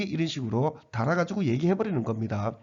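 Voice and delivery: ordinary talk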